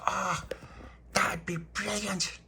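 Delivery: high, slow, delighted gasp; childlike delight. Retold or imitated. Little boy thrilled about building a cart, high and slow.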